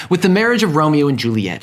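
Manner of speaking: ordinary talk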